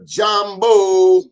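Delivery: high, loud, beaming Jambo; enthusiastic greeting. Retold or imitated. Host greeting East African viewers, high and loud with enthusiasm. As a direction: throw out Jambo with big enthusiasm. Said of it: "they said it with enthusiasm".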